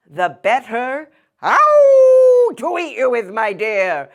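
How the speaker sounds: a sly wolf with a high howl; gloating wolf about to pounce; wolf revealed. Acted. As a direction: sly answer broken by a howl. Wolf's reveal.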